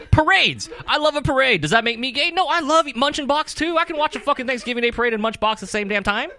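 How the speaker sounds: high-pitched, indignant gushing; hammed-up enthusiasm. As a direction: proclaim it in a high, excited rant. Hammed-up defence of liking parades.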